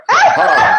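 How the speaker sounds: high squeaky startled "huh"; a comic yelp of shock from the prisoner; mock surprised grunt. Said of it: "He's like".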